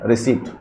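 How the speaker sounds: रिसीट, long ee, silent p; correct pronunciation. Shown as a demonstration. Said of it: "क्या होना चाहिए दोस्तों इसका प्रोनाउंसिएशन".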